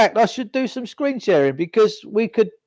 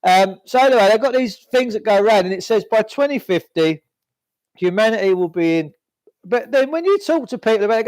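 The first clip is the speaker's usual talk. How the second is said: normal talking voice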